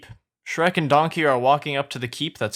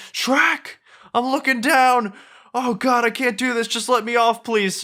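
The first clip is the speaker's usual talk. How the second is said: very high, frantic pleading; high-pitched panicky wail; panicked; 10 semitones higher than usual. Acted. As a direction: beg to get down in acted terror. Donkey panics over the lava.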